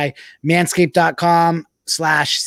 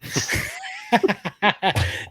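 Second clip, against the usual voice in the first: laugh; 13 semitones higher, 5 dB quieter than usual. Real, not acted. Laughing at friend's rant.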